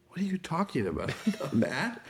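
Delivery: high, fast disbelieving protest with a laugh; incredulous protest. Retold or imitated. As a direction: brush off the accusation in surprise. Husband protests wife's accusation.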